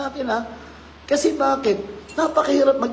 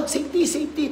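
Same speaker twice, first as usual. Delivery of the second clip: high call of safety, safety; a hint of a coworker calling from afar; 8 semitones higher than usual. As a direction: mimic an engineer calling out. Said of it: "tatawagin ka".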